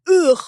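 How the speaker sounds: put-on high, loud little boy's "ugh"; disgusted "ugh". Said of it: "took one look at me and he went".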